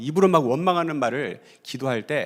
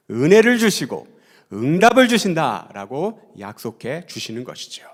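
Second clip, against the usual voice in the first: loud, emphatic sermon delivery with slowed scripture reading; forceful preaching; 4 semitones higher, 8 dB louder than usual. Acted. Pastor urging the congregation with loud preaching. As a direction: preach it out to the congregation with full conviction.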